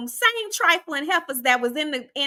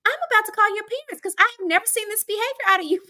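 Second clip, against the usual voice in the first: shocked principal; 7 semitones higher, faster than usual. Retold or imitated. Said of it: "She said".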